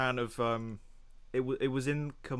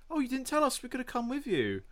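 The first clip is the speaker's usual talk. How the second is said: much higher, put-out voice; imitated family protest; 11 semitones higher than usual. Imitating family left out of a cinema trip, high-pitched protest. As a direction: pitch it right up as mock reproach.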